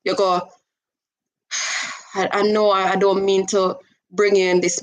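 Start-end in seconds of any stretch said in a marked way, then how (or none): 1.5-2.1 a deep sigh let out softly; soft breathy sigh on cue; demo sigh. Shown as a demonstration. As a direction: show a heavy sigh of being overwhelmed. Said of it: "you just take a deep sigh and you sigh out loud".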